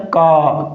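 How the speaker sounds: का for car, no r at the end; British non-rhotic. Shown as a demonstration. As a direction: say car as का, dropping the r. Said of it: "क्या बोला जाता है?". (का, British उच्चारण में आर के बिना)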